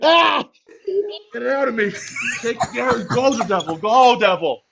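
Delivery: high, strained plea to the devil; mock exorcism. Driving the devil out of himself, mock exorcism. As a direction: cast it out in hammed-up anguish.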